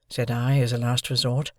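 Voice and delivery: ordinary delivery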